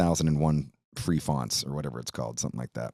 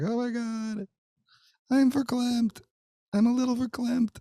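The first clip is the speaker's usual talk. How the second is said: high falsetto imitation of his wife, all verklempt; hammed-up high voice of a touched wife; mock choked-up wife. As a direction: squeak it out like a teary wife.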